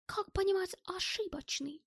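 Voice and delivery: high, uneasy foreigner's question; puzzled German character. A narrator's reading. German questions the mistaken account, puzzled high voice. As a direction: ask it high, taken aback. German frowns at bad news.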